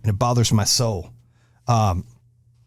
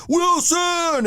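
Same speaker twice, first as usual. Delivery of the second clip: much higher desperate call of a castaway; Tom Hanks impression. Host imitating Tom Hanks calling for Wilson, high yell. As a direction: cry out the name like a stranded man. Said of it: "I fucking love when you're like".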